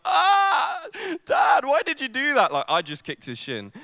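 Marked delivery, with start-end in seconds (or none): 0.1-2.5 high yelping cry of pain; child's pained shout. Retold or imitated. Little boy to dad after kicking his shin, pained shout. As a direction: yell out like a hurt five-year-old. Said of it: "I was like".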